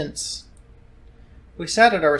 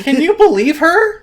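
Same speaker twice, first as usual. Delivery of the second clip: high, loud, slow outrage; outraged; 12 semitones higher, 11 dB louder than usual. Acted. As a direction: vent the outrage. Outraged complaint.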